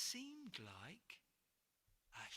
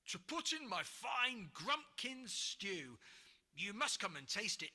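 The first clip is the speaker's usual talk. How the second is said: loud, proud troll voice; a troll bragging with a grin; grinning troll; 3 semitones higher, 6 dB louder than usual. Acted. Troll offering George his stew, grinning. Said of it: "he said with a grin".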